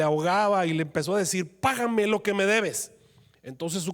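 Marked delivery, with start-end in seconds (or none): 1.6-2.9 slow, higher stern demand; demanding payment. Acted. Servant seizing his fellow servant, slowly demanding payment. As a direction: squeeze the debt out of him.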